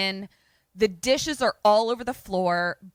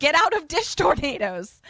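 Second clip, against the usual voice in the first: high-pitched exasperated command; emphatic exasperation; 7 semitones higher, 3 dB louder than usual. Real, not acted. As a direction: drive the obvious advice home. Exclaiming mock survival advice.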